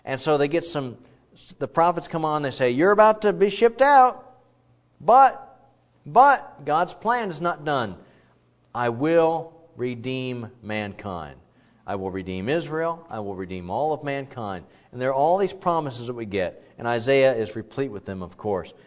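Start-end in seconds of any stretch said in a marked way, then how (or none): 2.7-8.0 prophet's proclamation. Acted. Prophets announcing exile. 8.7-14.6 solemn promise. Acted. God's promise voiced.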